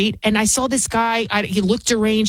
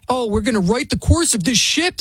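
mocking quote; 2 semitones higher than usual. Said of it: "Sonny Hostin said".

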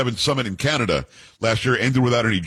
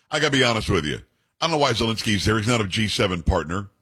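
normal talking voice

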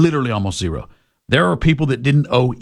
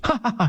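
mock laugh; 10 semitones higher, 4 dB quieter, faster than usual. Mocking the joke he just quoted.

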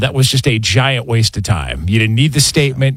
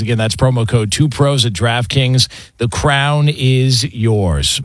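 ad read; 4 semitones higher than usual. Acted. Sponsor ad read.

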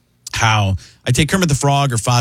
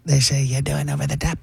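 ordinary delivery

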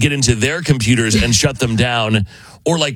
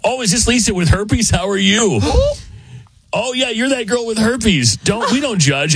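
mock sweet reply; 10 semitones higher than usual. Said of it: "response from the person attempting to be scammed".

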